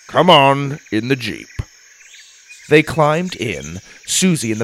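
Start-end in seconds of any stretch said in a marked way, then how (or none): 0.1-1.5 a mildly huffed order; huffy. A narrator's reading. Grandpa hurrying the others into the Jeep, huffy. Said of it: "Grandpa huffed".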